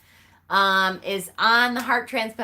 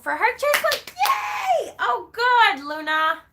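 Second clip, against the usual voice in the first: a real burst of joy, much higher and louder; excited shout; 14 semitones higher, 4 dB louder, slower than usual. Real, not acted. Friend Luna approved for transplant, cheering out loud. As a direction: cry out in delight at the news.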